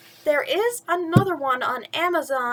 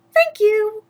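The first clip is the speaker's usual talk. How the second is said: higher, louder curt thanks; snooty stepsister; 9 semitones higher, 7 dB louder than usual. Acted. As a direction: toss it off haughtily.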